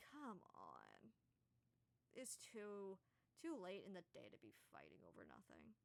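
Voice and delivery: a tired giant begging the others to stop arguing; sighing giant. Acted. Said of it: "poor giant person just sighs".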